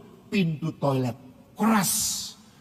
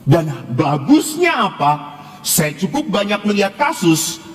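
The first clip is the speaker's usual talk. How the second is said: preaching; 9 dB louder than usual. Acted. Priest urging his congregation, preaching at full force. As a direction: drive the lesson home to the congregation.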